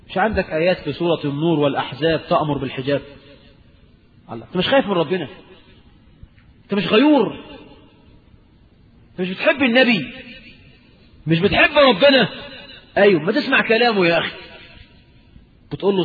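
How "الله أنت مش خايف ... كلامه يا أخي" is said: earnest scolding adviser. Retold or imitated. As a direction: plead and scold a careless friend. Voicing a pious adviser.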